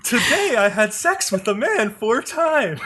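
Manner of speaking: slow put-on robot voice with crazy inflection; robot doll imitation. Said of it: "the cat robot says".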